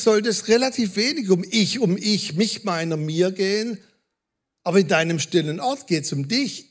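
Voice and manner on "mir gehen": normal talking voice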